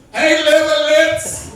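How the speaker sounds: high shouted taunt, drawn out; loud mocking call; mock kid yell. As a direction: yell it across the mall like a bratty kid. Kid yelling nickname at mall.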